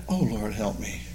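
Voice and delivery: soft, low plea for help; quiet prayer. Shown as a demonstration. Preacher showing how to cry out to God, soft and low. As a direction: breathe it as a humble cry.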